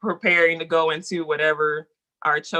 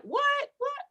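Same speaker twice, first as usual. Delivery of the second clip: high squeaky disbelief; amazed; 5 dB quieter than usual. Real, not acted. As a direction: mind blown, jump the pitch up. Amazed by plants helping humans.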